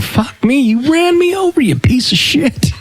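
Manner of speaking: high-pitched mock yell of outrage; retold angry yell. Cyclist yelling at the driver who ran him over, high mock fury. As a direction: replay the fury, voice jumping way up.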